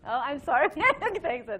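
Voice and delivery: high, loud, laughing aside; real laughter through a quick apology. Real, not acted. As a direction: burst out in an embarrassed laugh. Interrupts reading to apologise, laughing.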